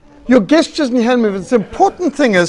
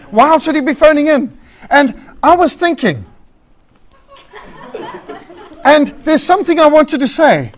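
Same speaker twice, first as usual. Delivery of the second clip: higher, louder, self-important caller droning on; rambling radio caller; 4 semitones higher, 5 dB louder than usual. Retold or imitated. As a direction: play the caller who won't get to the point. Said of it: "radio phone show".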